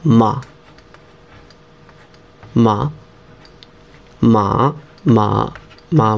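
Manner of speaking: মা with a dipping third tone; Chinese third tone ma. Shown as a demonstration. Teacher demonstrating মা in the Chinese third tone. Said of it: "যেমন এটা মা, third টন".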